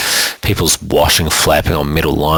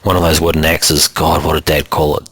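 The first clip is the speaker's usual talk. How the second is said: ordinary delivery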